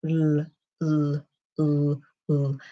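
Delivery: "l" repeated while the tongue slides back; shifting L. Shown as a demonstration. Teacher shows learners the L moving back. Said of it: "just see if you can shift the position of your tongue".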